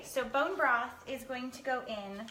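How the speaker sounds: ordinary talk, nothing marked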